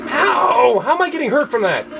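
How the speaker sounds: high, rushed protest; yelp, then complain fast and high-pitched; indignant yelp. Real, not acted. Player hurt by the squid for no clear reason, indignant yelp. Player keeps taking damage in game.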